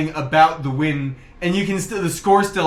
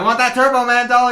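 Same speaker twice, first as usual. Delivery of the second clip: high, loud, eager demand; hammed-up movie rival; Sinbad; 9 semitones higher, 5 dB louder than usual. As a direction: covet it in a put-on high voice. Acts Sinbad in film.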